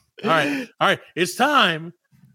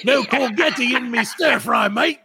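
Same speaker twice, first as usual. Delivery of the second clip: higher, slow hammed-up Cockney; mock British accent; 7 semitones higher than usual. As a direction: play a grumpy bloke in the kitchen. British sentence for word.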